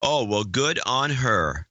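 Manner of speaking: sarcastic put-down, slowly; snide. Shown as a demonstration. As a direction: drag it out with a sneer. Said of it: "If you don't say it with the right intonta-, intonation".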